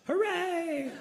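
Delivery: high-pitched, drawn-out hooray; mock cheer. Mock cheer at the party news.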